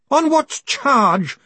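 high, loud, drawn-out indignation; haughty. A narrator's reading. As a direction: demand it slowly with injured hauteur. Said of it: "a last reserve of hauteur".

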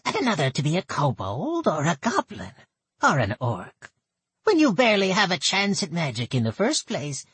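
put-on high, nasal old goblin woman; thick nasal goblin voice. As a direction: pinch it through the nose, goblin. Gertel speaks.

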